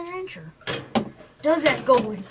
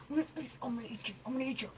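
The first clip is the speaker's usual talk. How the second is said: quiet threat; 2 semitones lower, 15 dB quieter, much faster than usual. Acted. Puppet threatens to eat.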